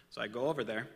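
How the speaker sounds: ordinary talk, nothing marked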